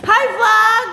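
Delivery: high, loud vlog hello; bright burst of a greeting; excited greeting. Real, not acted.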